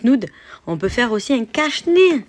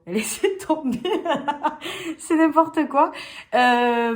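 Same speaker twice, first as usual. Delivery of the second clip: losing it laughing; 5 semitones higher, slower than usual. Real, not acted. [name] telling viewers to forget it, laughing helplessly. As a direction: crack up at your own silliness. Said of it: "je pète un plomb".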